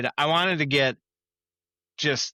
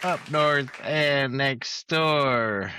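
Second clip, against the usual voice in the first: announcer; 5 semitones higher, much slower than usual. Acted. Show intro announcement.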